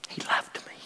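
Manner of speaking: soft, breathless whisper of awe; a hushed confession of his love. Real, not acted. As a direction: let it fall to a bare whisper. Hushed climax of the sermon.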